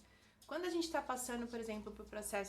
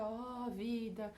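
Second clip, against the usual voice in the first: hammed-up lament, slow drawn-out words; mock self-pity, stretching each word out; over-dramatized woe; 3 dB louder, much slower than usual. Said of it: "Dramatizar demais aquela emoção".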